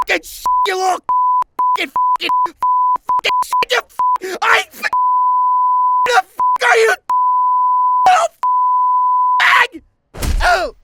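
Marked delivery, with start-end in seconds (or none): none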